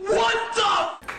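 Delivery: sudden high shout; startled shout. Real, not acted. Frustration after struggling.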